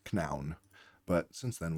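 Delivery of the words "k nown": KNOWN as "k-noun", K sounded; Middle English pronunciation. Shown as a demonstration. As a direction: say KNOWN with an audible K before the N. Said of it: "or in Middle English".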